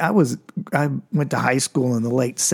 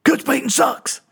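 high yelled taunt at the coach; yelled insult; 10 semitones higher than usual. Retold or imitated. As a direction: shout it up high, cheeky and bold. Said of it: "Coach just yells out".

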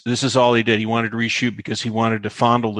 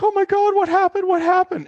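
alarmed shock. Retold or imitated. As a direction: imitate the panicked crew. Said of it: "everybody was like".

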